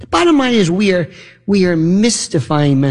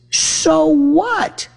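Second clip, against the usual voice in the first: high, rising scoff; mock scoffing; 11 semitones higher than usual. Host scoffing at an insanity excuse, high scornful rise.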